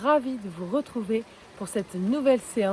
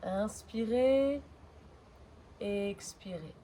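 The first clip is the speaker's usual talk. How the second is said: fading breath cues; 2 semitones lower, 6 dB quieter, slower than usual. Acted.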